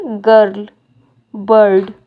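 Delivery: गर्ल and बर्ड said as separate model words; pronunciation demo. Shown as a demonstration. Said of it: "हम pronounce करेंगे". (गर्ल, बर्ड का उच्चारण अलग-अलग करके दिखाएँ)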